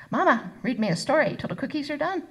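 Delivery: put-on high, pleading little-girl voice; eager small child. A narrator's reading. Child begging her mama for a story, eager. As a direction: ask like an excited toddler.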